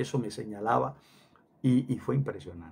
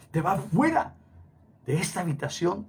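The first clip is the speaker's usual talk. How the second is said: higher-pitched order, punched on FUERA; retold command; 5 semitones higher than usual. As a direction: imitate someone ordering you out. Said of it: "así me dijo". (orden imitada, con voz aguda)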